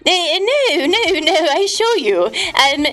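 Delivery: normal talking voice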